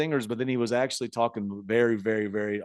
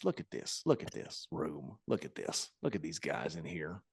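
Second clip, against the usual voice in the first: softer, low half-whisper pointing people out; a sly whispered aside; hushed aside; 9 semitones lower, 13 dB quieter than usual. Retold or imitated. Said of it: "He's like".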